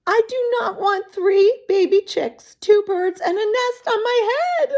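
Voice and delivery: exasperated elephant cry; loud protest. Acted. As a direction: Elephant at wits' end. Elephant overwhelmed.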